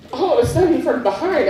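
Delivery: ordinary talk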